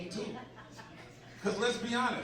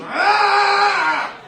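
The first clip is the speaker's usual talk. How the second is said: angry yell; 20 dB louder than usual. Pretend. Mimics angry cartoon drawing.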